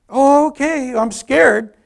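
loud, high mock-nervous agreement; scared giving in. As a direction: give in with exaggerated nerves.

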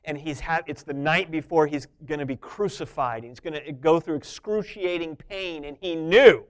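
ordinary talk

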